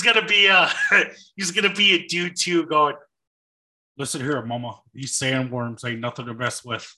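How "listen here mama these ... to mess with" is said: low, soft rock-and-roll impression. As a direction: drop low for a joking singer impression. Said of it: "doing the [name] voice for Dune two".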